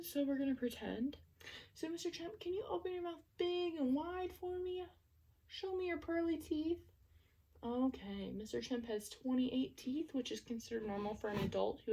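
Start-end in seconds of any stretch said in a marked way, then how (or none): 1.7-6.8 higher, coaxing voice for a little patient; talking to a child. Acted. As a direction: coax the child to open wide. Asking toy patient to open mouth.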